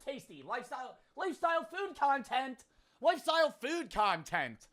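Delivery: high, put-on mocking voice; high, sing-song mockery; mocking company voice. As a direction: mimic a content brand in high pitch. Mocks a channel's self-description.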